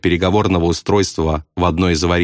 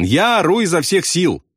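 high, strained-to-be-heard shout, volume held in; shout back; 9 semitones higher than usual. A narrator's reading. Pilot protests he is yelling, high shout. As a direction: call out high as if over a din. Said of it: "крикнул ему в ответ".

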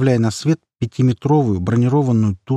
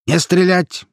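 authoritative raised-pitch order; commanding; 8 semitones higher, 3 dB louder, slower than usual. A narrator's reading. Commander halts the gunfire, commanding. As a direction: call it out like a commander. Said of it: "властный голос командира".

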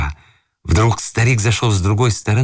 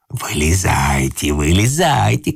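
drawn-out angry command; a stamping old man's order, mildly shouted; shouting; 4 semitones lower, slower than usual. A narrator's reading. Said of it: "кричал старик".

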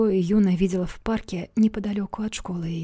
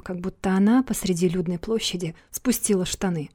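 ordinary delivery

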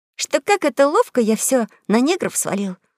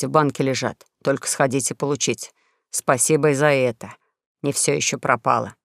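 low, mocking old swindler; deep, sour con man; sneering duke; 5 semitones lower, 3 dB louder than usual. Duke's bitter speech.

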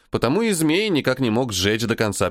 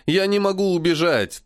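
ordinary delivery

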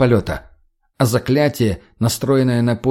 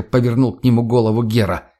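ordinary delivery

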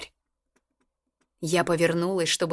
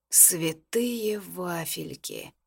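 slow, soft shocked whisper; 6 dB quieter, much slower than usual. A narrator's reading. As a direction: breathe it out in dismay. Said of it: "прошептал Мирон".

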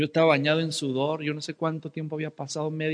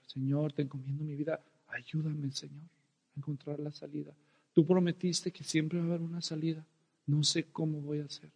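softer, hushed plea to God; calm prayer; 8 dB quieter than usual. Retold or imitated. Man trapped under truck praying to God, hushed calm prayer. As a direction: pray quietly, surrendering. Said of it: "me serené y empecé a orar".

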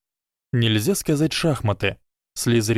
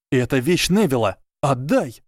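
mildly raised, higher narrated shout; shouted demand; 3 semitones higher than usual. A narrator's reading. Ernie demands Neville's thing back from Goyle, restrained shout. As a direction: demand it back, calling out lightly. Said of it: "крикнул Эрни".

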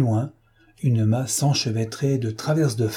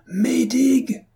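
yelling a name; 13 semitones higher than usual. A narrator's reading. Fotheringay screams for Maydig in the storm, desperate yell. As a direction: cry out for your friend over the storm. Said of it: "hurla la voix de monsieur Fotheringay".

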